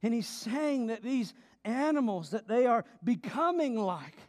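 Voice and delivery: normal talking voice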